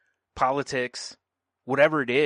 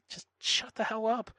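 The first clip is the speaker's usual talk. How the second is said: high, soft mocking voice of a hater; mock hater snap; 9 semitones higher, 6 dB quieter than usual. Mocking a hateful fan, high and soft. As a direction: sneer it like an online hater.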